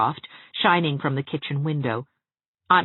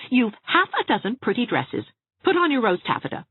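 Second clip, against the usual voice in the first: exasperated Anne; 9 semitones higher, 4 dB louder than usual. A narrator's reading.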